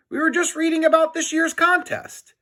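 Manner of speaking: put-on lifted, innocent kid voice; high boy voice. George fibbing innocently to the suspicious principal, high. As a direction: play it innocent in a high boy's voice. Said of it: "said George".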